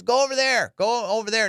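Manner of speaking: high mock order; high pitched lady barking directions; bossy lady. Staff lady ordering the crowd, high mock command. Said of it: "And she goes".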